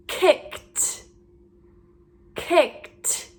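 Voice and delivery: "kicked" with a separate released t; audible t ending. Shown as a demonstration. Teacher modelling for learners to hear t, "kicked" ending in t. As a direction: say "kicked" alone, finishing on a breathy t. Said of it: "Do you hear the t?".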